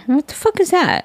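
high startled "what the fuck is that"; mock startled outburst; retold shock. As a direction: replay the alarmed double take. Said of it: "I'm like".

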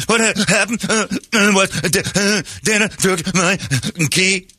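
mock blubbering child. Crying son explaining to dad, high stammering blubber. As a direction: blubber like a little kid.

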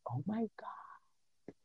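breathless, slow whispered disbelief; a hushed, drawn-out oh my God; stunned whisper. Retold or imitated. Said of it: "I'm just like".

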